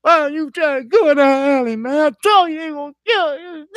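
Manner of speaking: high, tearful scolding of his friend; crying scolding. Retold or imitated. Scolding his friend while crying, high tearful rebuke. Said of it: "you crying, you like".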